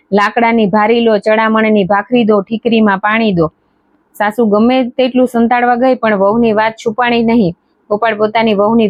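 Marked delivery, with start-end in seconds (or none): none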